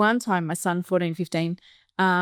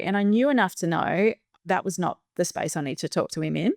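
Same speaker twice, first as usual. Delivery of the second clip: ordinary talk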